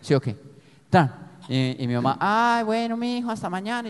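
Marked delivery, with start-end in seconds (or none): 2.2-3.9 mock mom voice. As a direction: imitate his mother saying goodnight. Mother voiced in story.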